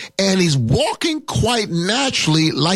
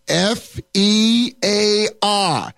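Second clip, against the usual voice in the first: slow, letter by letter; spelling out; 2 semitones higher, much slower than usual. Shown as a demonstration. Preacher spells fear for the congregation, slowly letter by letter. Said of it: "how you spell fear".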